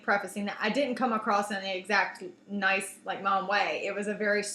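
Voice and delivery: ordinary talk, nothing marked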